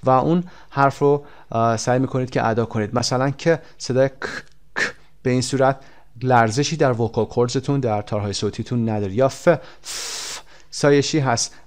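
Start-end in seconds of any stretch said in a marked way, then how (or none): 4.1-4.9 bare K, no vibration in the throat; voiceless K sound. Shown as a demonstration. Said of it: "on voice هستن، از خودشون صدایی ندارن". 9.3-9.5 drawn-out F, only air escaping; voiceless F sound. Shown as a demonstration.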